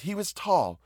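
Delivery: high, urgent woman pressing her case; earnest, high-voiced appeal; pleading woman. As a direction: plead anxiously, pitched well up.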